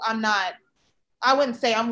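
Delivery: normal talking voice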